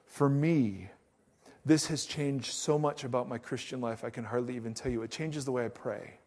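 ordinary talk

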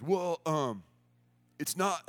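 lightly voiced skater dude; a hint of a laid-back skater guy; skate camp guys. Skateboard camp worker explains camp to a mom, in a skater-dude voice. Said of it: "this is her impression of these guys".

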